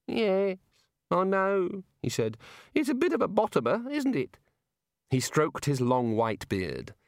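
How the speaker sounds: high rueful god voice; sheepish god. A narrator's reading. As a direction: admit it ruefully.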